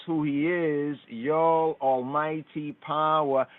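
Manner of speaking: normal talking voice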